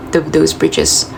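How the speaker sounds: ordinary talk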